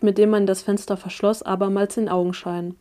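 plain reading voice

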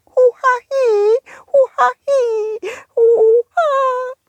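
high squeaky worm whimpering; a tiny knotted creature crying. Acted.

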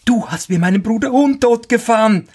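high, quick sparrow outcry; accusing cry. A narrator's reading. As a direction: accuse the carter in a high bird voice. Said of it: "Da rief der Sperling". (hoher, anklagender Spatzenruf)

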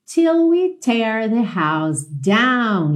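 very slow, drawn-out English; slow model line. Shown as a demonstration. Teacher modelling the lyric for students, very slow. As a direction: stretch each word of the phrase.

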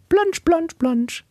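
high playful plansch plansch; very high mock splashing; splash noises. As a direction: squeak out the splashing. Splashing sound imitated.

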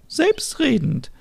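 put-on very high, thin old-lady voice; old neighbour offering help, high and frail; elderly Merit. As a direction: play a sweet old neighbour.

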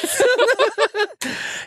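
shrieking laugh, way up high; high giggling fit. Real, not acted. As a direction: let a squeal of laughter out. Laughing at wedding joke.

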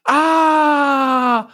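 sudden high startled cry of realisation; sharp high gasp-shout of surprise; surprised shriek. Real, not acted. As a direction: yelp out in shock. Realises forgotten calendar door.

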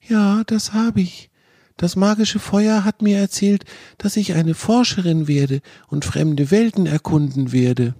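put-on a small fox girl announcing her fate solemnly; serious little girl. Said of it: "schaute ihn sehr ernst an und erwiderte".

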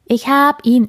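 high, loud, bouncing with excitement; little bear shouting ich hab ihn; excited cub. Acted. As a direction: cub spotting the star picture, thrilled. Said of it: "hopste aufgeregt auf und ab".

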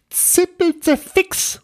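very high, slow, drawn-out ghost oath; cursing little ghost. Acted. Little ghost forgot its own name, high exasperated voice. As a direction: squeak a drawn-out tiny curse.

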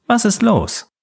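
rising fear, pitch shooting up on Was; panicked. Acted. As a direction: voice jumping high in panic. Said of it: "ihre Hände zitterten".

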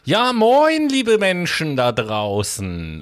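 sing-song, slow hello to listeners; drawn-out greeting. Acted.

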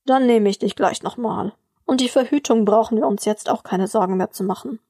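usual speaking voice

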